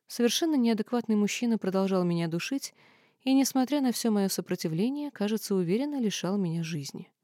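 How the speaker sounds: ordinary delivery, nothing marked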